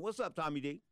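usual speaking voice

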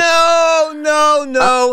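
loud, high emphatic no; shouting no. Real, not acted. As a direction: shout down the idea. Loudly rejects co-host's ranking.

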